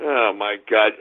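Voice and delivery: ordinary delivery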